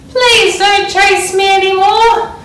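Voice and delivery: high, loud, slow plea to the crowd; pleading. A narrator's reading. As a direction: beg the fans to stop. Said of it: "she asked the screaming crowd".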